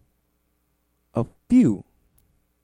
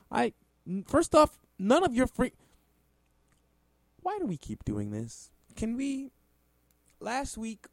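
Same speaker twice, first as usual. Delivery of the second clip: exasperated outburst; 12 semitones higher than usual. Real, not acted. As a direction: pitch jumps way up, sputtering. Sputtering outrage.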